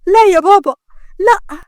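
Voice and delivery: high, loud desperate cry; forceful scream. Acted. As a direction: cry out to stop him, pitched well up. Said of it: "صرخت بقوة".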